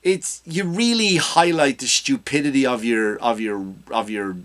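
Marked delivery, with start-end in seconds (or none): none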